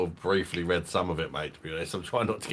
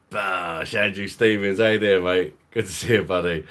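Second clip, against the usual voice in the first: loud greeting; 7 dB louder than usual. Real, not acted. Hailing a viewer who joined chat.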